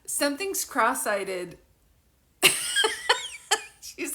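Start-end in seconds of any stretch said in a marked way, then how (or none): 2.4-3.8 shrieking burst of laughter; high-pitched giggle fit; high squealing laugh. Real, not acted. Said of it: "cannot stop laughing".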